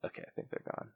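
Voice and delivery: barely audible, unvoiced whisper; cautious whisper. Real, not acted. Host checking whether the callers left, whispering. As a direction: whisper it, still afraid of being heard.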